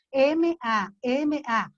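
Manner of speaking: slow, each letter of "M A M A" spoken apart. Shown as a demonstration. Teacher sounds out mamá letter by letter for parents. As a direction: sound out "M A M A" one letter at a time, slowly. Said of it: "haciendo énfasis en cada letra".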